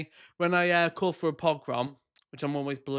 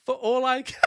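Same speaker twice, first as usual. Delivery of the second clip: mocking repeat; 10 semitones higher than usual. Repeating quote in disbelief.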